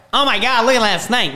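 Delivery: mock freak-out, high and loud; high-pitched, astonished outburst, playfully imitated; Conan O'Brien. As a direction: imitate a host going off in disbelief. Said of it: "he's going off. He can't believe it".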